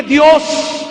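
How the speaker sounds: loud, forceful preacher's declaration; triumphant proclamation. Retold or imitated. Patient answers the doubting doctors, triumphant proclamation. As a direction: proclaim it at full voice.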